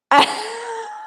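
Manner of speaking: high, real laugh breaking out; burst of laughter. Real, not acted. Vlogger laughs at her comeback to the Parisian girls, real laugh. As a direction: crack up at your own punchline.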